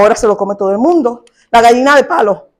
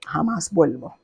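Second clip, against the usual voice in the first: hushed vow; 9 semitones lower, 13 dB quieter than usual. Retold or imitated.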